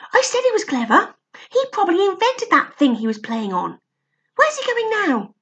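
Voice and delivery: put-on high, lively boy's voice; eager boy. Barney talking about Stig, excited boy voice.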